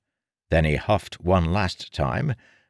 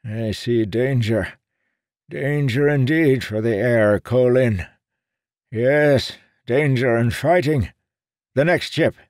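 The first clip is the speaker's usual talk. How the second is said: put-on old seer voice; 8 semitones higher, 5 dB louder than usual. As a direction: intone like an old fortune-teller.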